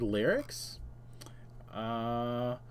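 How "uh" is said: usual speaking voice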